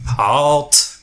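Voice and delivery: acted sentry's call; guard's challenge. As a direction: call out a halt from a tower lookout. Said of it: "the guy on the top goes".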